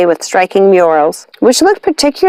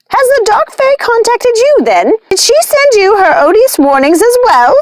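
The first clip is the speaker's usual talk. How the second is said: high, sharp witch voice; angry witch. A narrator's reading. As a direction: snap the question at them in anger. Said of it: "Lucinda was quick to anger".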